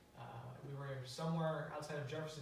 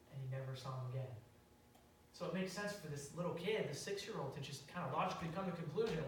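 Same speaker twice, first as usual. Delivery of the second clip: usual speaking voice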